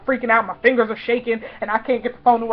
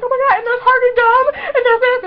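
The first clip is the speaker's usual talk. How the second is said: high, loud excited babble; squealed gushing; 14 semitones higher, 6 dB louder than usual. Retold or imitated. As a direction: blurt it in fangirl glee. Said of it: "But I was so excited".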